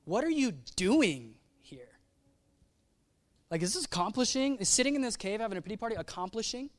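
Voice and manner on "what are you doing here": DOING swooped up, slowly; slow question punching DOING, HERE trailing after; stress on DOING. Shown as a demonstration. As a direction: lean hard on DOING. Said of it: "Or what if he said it this way".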